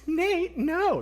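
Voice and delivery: high playful mom voice, mock scolding; high-pitched mock-scolding mom. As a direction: chide him like a giggling mother. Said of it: "And she goes".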